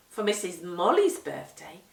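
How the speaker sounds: usual speaking voice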